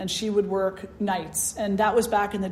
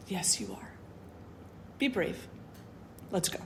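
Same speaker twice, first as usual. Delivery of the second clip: much softer, near whispered encouragement; gentle coaxing; 2 semitones lower, 13 dB quieter than usual. Retold or imitated. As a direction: quietly urge her to be brave. Grandmother coaxes child to be brave.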